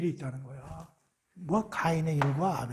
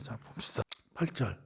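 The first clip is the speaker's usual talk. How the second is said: whispered aside; 7 semitones lower, 8 dB quieter than usual. Real, not acted. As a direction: breathe the words under your breath. Aside while finding verse. (속삭이는 혼잣말)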